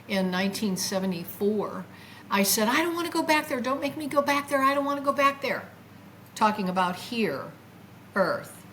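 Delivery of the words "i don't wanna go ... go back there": high, insistent begging in a rush; retold pleading. Retelling her plea to the angel returning her, begging. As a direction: plead high, one phrase tumbling into the next. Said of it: "I remembered saying to the angel".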